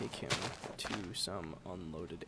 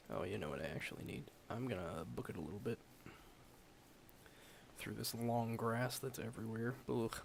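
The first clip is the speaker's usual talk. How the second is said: ordinary delivery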